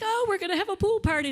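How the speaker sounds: high, loud, quick burst of enthusiasm; mock excited. Acting out staff teasing tired campers.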